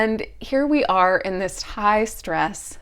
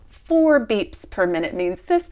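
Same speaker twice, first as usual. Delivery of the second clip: exasperated; 6 semitones higher, 5 dB louder, slower than usual. Real, not acted. Building exasperation.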